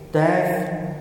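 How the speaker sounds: ordinary delivery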